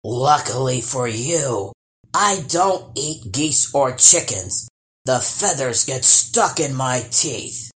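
a big troll being friendly in his own way. Acted. Troll keeps talking.

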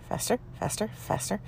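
whispered, urgent repeats. Retold or imitated. Urging her ex to speed up, urgent whisper.

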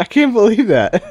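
high laughing disbelief; real laughter. Real, not acted. Laughing at the surprise caller.

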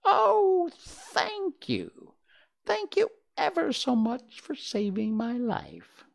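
very high, tiny squeaky mouse voice; squeaky little voice. Acted. Grateful field mouse thanking the Woodman, very high squeak. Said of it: "in a squeaky little voice".

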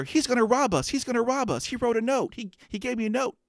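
high, frantic imitation of the teller; a mock-alarmed bank teller voice, pitched well up; panicked teller. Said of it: "I can hear the teller".

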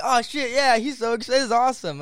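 high, loud gush of retold enthusiasm; excited and amped. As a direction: imitate someone totally amped. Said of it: "how excited and amped you were".

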